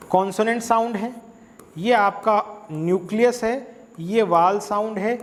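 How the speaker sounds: normal talking voice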